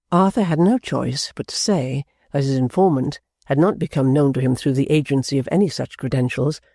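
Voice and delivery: ordinary delivery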